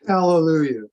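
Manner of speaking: loud relieved cheer; mock hallelujah. Student cheering at the teacher's verdict, loud mock relief.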